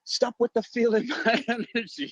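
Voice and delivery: high mock scold breaking into laughter; laughing mock mom. Host imitating guest's mom, high and laughing.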